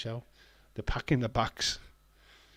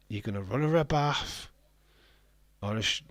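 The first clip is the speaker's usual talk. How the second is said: normal talking voice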